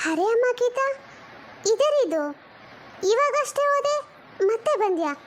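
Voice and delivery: high, startled call to her daughter; surprised greeting. Acted. Mother surprised to see daughter back so soon, calling out high. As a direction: sound caught off guard by her return. Daughter returns unexpectedly home.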